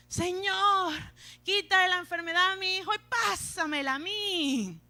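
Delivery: loud, high mock pleading; imitated anguished plea, hammed up; desperate parent's prayer. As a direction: beg God dramatically, stretching the words. Mimicking desperate parents praying.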